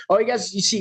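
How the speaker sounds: ordinary talk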